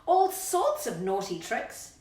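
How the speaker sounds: lively rhyming read-aloud, lightly dramatised; reading to children. Acted. Reading a rhyming story to Rocky, lively and playful. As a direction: read it to a little one, relishing the yuck.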